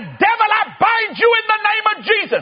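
fiery shouted prayer; commanding rebuke. Acted. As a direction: bind the devil with full force. Rebuking devil at full force.

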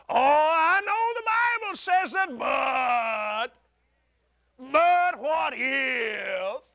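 mock a fearful believer pleading; whiny doubting wail. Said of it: "That's sin consciousness talking like that".

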